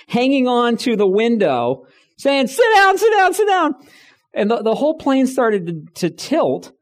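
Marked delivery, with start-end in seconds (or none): 2.5-3.7 mock frightened plea. Curled up alone, pleading passengers.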